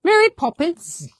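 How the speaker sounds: Mary Poppins in a high, playful English accent; quick mock English accent on request. Shown as a demonstration. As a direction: put on a bright English accent for the name. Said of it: "You gotta say it all in an English accent".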